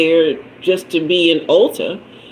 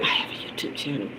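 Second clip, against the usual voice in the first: breathy secret whisper, no voice at all; 4 semitones lower, 14 dB quieter, faster than usual. Retold or imitated. Whispering to the store clerk about her channel. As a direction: hush it like a confession. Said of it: "but I said".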